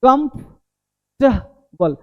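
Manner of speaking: कंफर्टेबल slowly split, "kumf... ta... bal"; syllable by syllable. Shown as a demonstration. Teacher shows learners the parts, slowly broken up. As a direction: break कंफर्टेबल into three reduced chunks. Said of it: "देखो".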